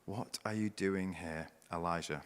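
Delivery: quiet, gentle voice of God; soft God voice. Acted. God asking Elijah in the cave, quietly and gently. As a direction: ask it softly, like a gentle whisper. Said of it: "the voice said to him".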